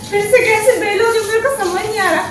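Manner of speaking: high, loud laughing gripe; giggly mock outrage; laughing complaint. Real, not acted. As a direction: tease him while laughing at the mess. Laughing complaint about husband's cooking.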